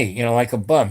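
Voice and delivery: ordinary talk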